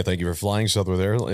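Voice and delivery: low slow monotone pilot voice. Retold or imitated. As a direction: drone it like an airline captain. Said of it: "that monotone voice".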